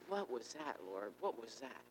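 softly puzzled questions to the Lord; quiet bewilderment. Retold or imitated. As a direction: ask it under your breath, baffled. Retold bewildered questioning of God.

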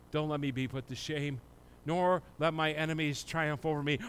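soft, low prayer voice with long pauses; hushed prayer. Acted. Pastor praying with the congregation, soft and hushed. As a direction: pray it quietly to God.

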